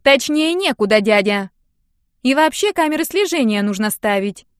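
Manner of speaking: a careless Нинка lecturing the guard, loud and offhand. Acted. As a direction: brush the old man off. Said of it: "небрежно ответила она".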